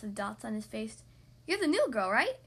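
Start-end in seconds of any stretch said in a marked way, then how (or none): 1.5-2.4 bright friendly question; cheerful. A narrator's reading. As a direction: ask it with a grin. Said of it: "he asked cheerfully".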